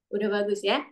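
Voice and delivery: ordinary talk, nothing marked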